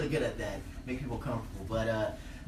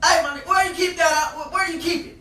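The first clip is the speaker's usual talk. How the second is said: high, insistent shout; yelled out; 13 semitones higher, 9 dB louder than usual. Retold or imitated. Nervous driver yelling to a man on horseback, high shout. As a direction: holler across to the rider, very loud. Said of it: "And I yelled out".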